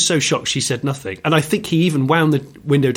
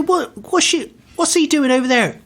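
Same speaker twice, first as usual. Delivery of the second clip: much higher, exasperated imitation of the instructor; ranting instructor. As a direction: rant at the weaving driver, half outraged. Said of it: "he'd go".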